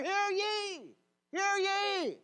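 high, loud herald's proclamation; drawn-out crier's holler; town crier. Acted. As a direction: call it out across the square. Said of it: "Herald the town car".

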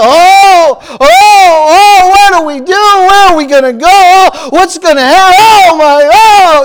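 high, loud whiny fake crying; mock whimpering panic. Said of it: "this is how Christians are".

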